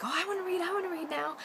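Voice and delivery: put-on very high, pleading kid voice; high-pitched, excited child impression; eager children imitation. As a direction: mimic kids clamoring for a turn.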